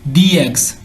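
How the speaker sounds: "दी eggs" with long ee before the vowel; THE as "dee". Shown as a demonstration. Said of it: "दी पढ़ेंगे".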